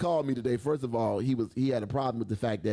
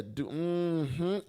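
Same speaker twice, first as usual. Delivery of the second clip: normal talking voice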